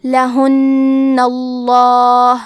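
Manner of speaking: لہن اللہ very slow, doubled نّ and heavy لام in اللہ; heavy lam. Shown as a demonstration. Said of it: "حرف اللام کو موٹی آواز میں پڑھیں گے".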